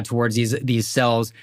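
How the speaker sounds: ordinary talk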